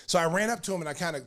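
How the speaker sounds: ordinary talk, nothing marked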